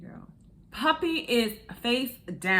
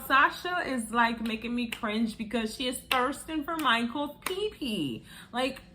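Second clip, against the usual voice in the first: ordinary talk, nothing marked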